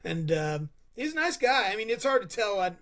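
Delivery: ordinary talk, nothing marked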